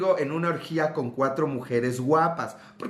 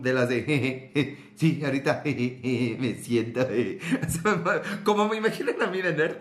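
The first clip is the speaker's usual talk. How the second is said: ordinary delivery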